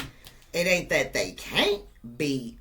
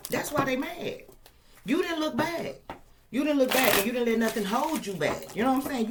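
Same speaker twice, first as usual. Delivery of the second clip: ordinary talk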